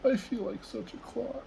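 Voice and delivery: high, small giraffe voice, a touch sad; Gerald's sad thought. Acted. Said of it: "he thought".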